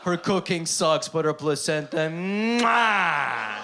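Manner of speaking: bragging host. Pretend. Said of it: "I'll be like".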